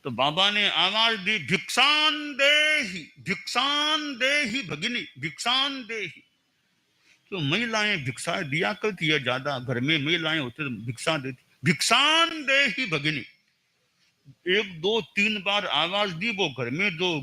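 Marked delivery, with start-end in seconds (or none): 1.4-6.2 calling for alms. Acted. Monk calling at closed door. 11.7-13.2 calling for alms. Acted. Monk calling at closed door.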